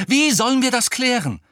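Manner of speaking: high-pitched, impatient demand; agitated challenge. A narrator's reading. Harry demanding Hermine's plan, exasperated. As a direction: ask it in exasperation.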